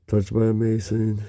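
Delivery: usual speaking voice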